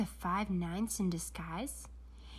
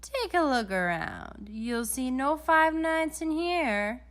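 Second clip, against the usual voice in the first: higher, slow, sly voice; lightly voiced smug villain; Doctor Brock; 6 semitones higher, 6 dB louder, slower than usual. A narrator's reading. Doctor Brock invites the detective in, slow and sly.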